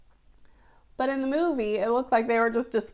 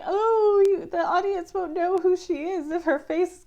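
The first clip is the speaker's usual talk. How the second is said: higher, mock-worried filmmaker voice; imagined filmmakers; 7 semitones higher, 4 dB louder than usual. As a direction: raise the pitch to mimic someone fretting. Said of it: "they're probably like".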